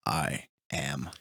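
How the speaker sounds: low, soft, slow solemn delivery; a quiet final declaration; hushed Iron Man line. Retold or imitated. Iron Man's last words to Thanos, low and hushed.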